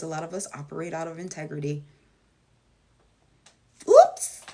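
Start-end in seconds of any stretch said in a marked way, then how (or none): 3.9-4.4 squeaky, loud, far higher yelp; startled little yelp at herself; sudden oops. Real, not acted. Realizing she leaned into the camera, loud startled yelp. Catches herself too close.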